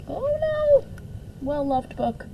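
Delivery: ordinary delivery, nothing marked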